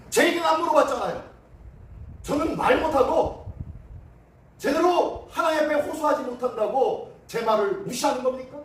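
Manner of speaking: wronged pupil's high, rising remonstrance; indignant protest. Acted. Wronged student confronting the teacher over fairness, indignant protest. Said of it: "라고 따지는 거예요".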